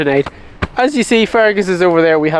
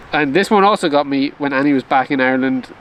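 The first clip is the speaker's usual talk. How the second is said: usual speaking voice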